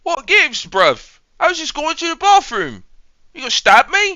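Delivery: very high, indignant British squeal; mock British. Bystander asking if he'll be stabbed, high mock British voice. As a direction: protest in a squeaky put-on British accent.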